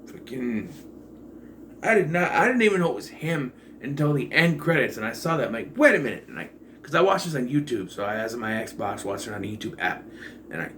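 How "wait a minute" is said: higher, louder mock double take; startled double take. Reviewer retells spotting the actor in the end credits, startled. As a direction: replay your sudden disbelief.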